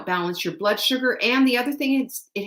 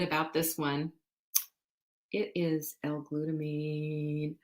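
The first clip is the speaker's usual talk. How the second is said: hushed reveal; 4 semitones lower, 10 dB quieter, slower than usual. Real, not acted. Dramatic reveal of product.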